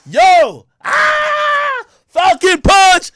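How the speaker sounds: loud, high hype yell; excited greeting. Retold or imitated. Host re-enacting greeting the Migos, excited shouting. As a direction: scream it like an overjoyed fan. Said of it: "So I'm excited".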